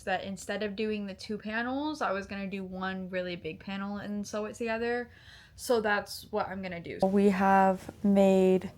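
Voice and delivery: real hushed talk while working. Real, not acted. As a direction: keep it down, talking under your breath. Talks hushed while crocheting.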